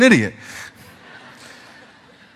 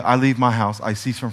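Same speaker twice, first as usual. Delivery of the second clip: normal talking voice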